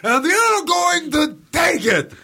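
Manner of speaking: high, slow shouted clown threat; creepy clown. Pretend. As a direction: howl it like a killer clown.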